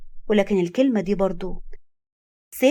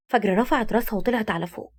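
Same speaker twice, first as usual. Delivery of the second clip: ordinary delivery, nothing marked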